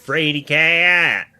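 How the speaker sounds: high, loud, drawn-out teasing; mock taunt. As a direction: stretch it out like a playground jeer. Quotes the film's taunt.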